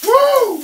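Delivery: high, loud happy whoop; excited whoop. Real, not acted. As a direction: whoop with glee. Whoop adding pepper.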